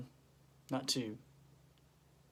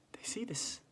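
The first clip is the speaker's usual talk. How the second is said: whispered, soft and eerie; spooky storyteller hush; hushed suspense; 8 semitones higher, 7 dB quieter than usual. Acted. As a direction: drop to a whisper of wonder. Suspenseful sighting of a mystery.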